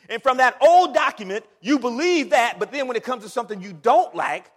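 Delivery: loud, high-pitched preaching; forceful challenge. Real, not acted. Preacher confronting selective believers, loud forceful challenge. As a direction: hit them hard with the contradiction.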